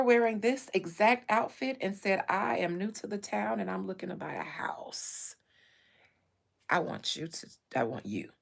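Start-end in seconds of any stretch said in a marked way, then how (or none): 2.3-5.3 unvoiced whisper of the client; breathy secret pitch to her. Quoting the client, whispered. 6.7-8.3 hushed come-on. Retold or imitated. Client's line, deep and hushed.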